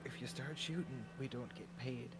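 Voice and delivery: leaning in to whisper. Retold or imitated. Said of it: "whisper tone and goes".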